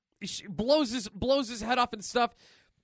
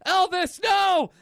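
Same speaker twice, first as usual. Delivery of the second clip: higher, louder cry of alarm; hammed-up yell; mock shout; 8 semitones higher, 6 dB louder, slower than usual. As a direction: call out after the falling friend. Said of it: "Ash calls out to Elvis".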